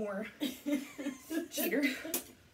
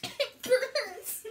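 pained cry; 11 semitones higher, 3 dB louder than usual. Real, not acted. Eating hot spicy food.

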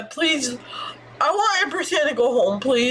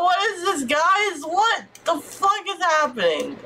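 ordinary talk, nothing marked

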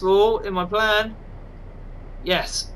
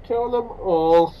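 slow, menacing villain line; slow masked villain menace; Bane impression; slower than usual. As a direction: threaten slowly like Bane. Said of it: "as Bane".

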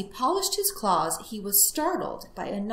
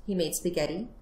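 ordinary delivery

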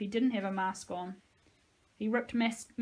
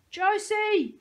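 screamed name, much higher and louder; 12 semitones higher, 9 dB louder than usual. Acted. The kids scream for Josie as she runs. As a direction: scream her name after her. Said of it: "we all screamed".

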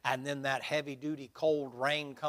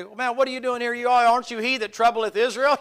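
higher and louder, a hammed-up king shouting across; mock king calling out; 9 semitones higher, 11 dB louder than usual. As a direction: call over to the runner in mock outrage. King shouts at running Elijah.

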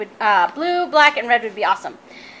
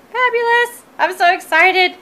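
high, louder, thrilled delight; real excitement; 7 semitones higher, 5 dB louder than usual. Real, not acted. Crafter seeing the vinyl she was sent, excited. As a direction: let the delight push the pitch right up.

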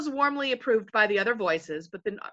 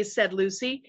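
ordinary delivery

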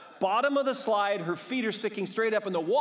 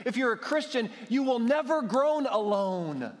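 rising, urgent sermon build; preaching; 4 semitones higher than usual. Acted. A pastor urges his church, growing preaching force. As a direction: press the church toward the promise.

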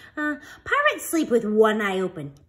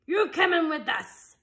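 pirate captain; 6 semitones higher than usual. A narrator's reading. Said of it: "Braidbeard told me".

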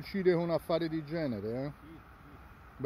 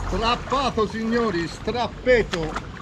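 mock announcer; 6 semitones higher, much slower than usual. Real, not acted. Announcing to viewers.

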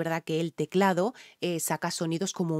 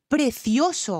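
1 gushing emphasis; 6 semitones higher, 4 dB louder, slower than usual. Real, not acted.

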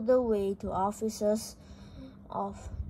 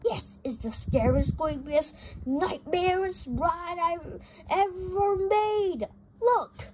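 boastful ride maker; 8 semitones higher than usual. Acted. Ride builder shows off his scary ride, high and proud. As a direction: climb higher with each word.

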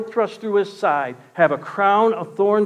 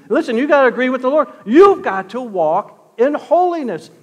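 higher-pitched, insistent sermon delivery; impassioned preaching; 6 semitones higher, 7 dB louder than usual. Real, not acted. Preacher urging people to accept how God made them, impassioned. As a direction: press the point home like a fired-up preacher.

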